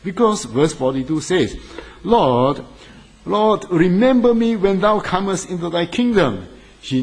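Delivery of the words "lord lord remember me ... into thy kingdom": higher and louder, a desperate plea for mercy; raised pleading cry. Acted. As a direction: cry out like a dying man begging. Thief's plea quoted in sermon.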